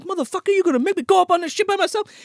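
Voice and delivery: high, rapid put-on complaint; indignant uncle impression. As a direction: squawk it in outraged disbelief. Imitating abandoned uncle's outraged complaint.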